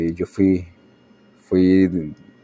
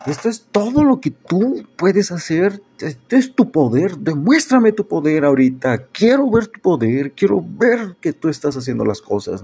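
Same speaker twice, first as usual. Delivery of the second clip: much higher, pleading-angry challenge; defiant demand to God; 10 semitones higher than usual. Retold or imitated. Preacher reliving defying God, pitched-up angry demand. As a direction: act out daring God, voice pitched up. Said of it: "Yo le decía a Dios así".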